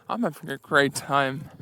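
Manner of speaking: ordinary talk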